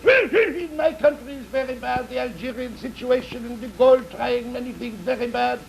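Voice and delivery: put-on high-pitched French-accented poodle; French-accented little dog voice. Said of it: "The French dog says".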